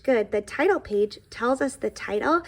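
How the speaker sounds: plain reading voice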